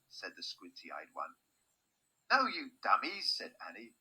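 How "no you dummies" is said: high, exasperated retort; indignant scolding. A narrator's reading. Annie scolds the guards, high indignant retort. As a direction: scold them in a raised voice.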